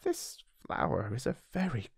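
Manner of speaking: soft, breathy aside to himself; hushed little prince aside; whispered aside. A narrator's reading. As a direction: whisper it as a private thought. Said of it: "added to himself".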